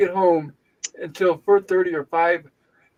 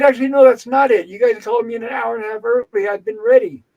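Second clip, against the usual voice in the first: higher, louder, insistent pushback; indignant retort; 5 semitones higher, 5 dB louder than usual. Retold or imitated. Patient disputing the nurse's excuse for the wait, indignant. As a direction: argue back in a retold quarrel, pitched up.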